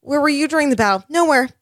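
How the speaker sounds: high, scolding child-lord impression; Lyanna Mormont. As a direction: call out the lords like a fierce young lady. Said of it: "And Lyanna Mormont's like".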